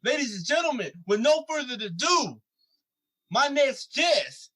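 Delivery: punchy hype-man intro with swooping pitch, each phrase falling at the end; podcast intro announcer. Acted. Hosts the show's announced intro.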